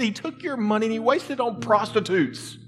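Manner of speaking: high, loud indignant accusing voice; resentful older brother. Retold or imitated. Older brother accusing his father, high and indignant. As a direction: rail at the father as the jealous brother. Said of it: "He's like".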